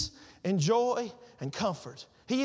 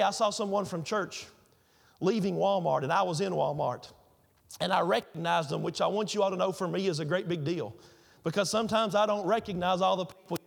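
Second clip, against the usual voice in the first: ordinary talk, nothing marked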